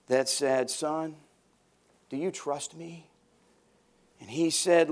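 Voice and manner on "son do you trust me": soft, calm heavenly voice. Retold or imitated. God answers the dangling man, soft and calm. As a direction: speak gently from above. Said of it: "he heard a voice from the heavens".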